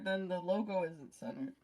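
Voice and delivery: normal talking voice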